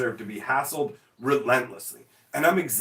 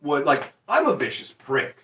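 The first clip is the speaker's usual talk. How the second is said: ordinary delivery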